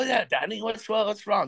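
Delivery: lightly imitated French-Canadian accent; teammate's French accent, lightly done. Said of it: "him in his eloquent French accent".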